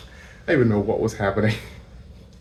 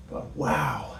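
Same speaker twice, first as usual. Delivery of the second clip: ordinary talk, nothing marked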